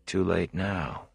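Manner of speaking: soft, slow, inward thought with TOO leaned on; inner thought. A narrator's reading. Rand thinking to himself, a soft slow inner thought. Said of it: "he thought".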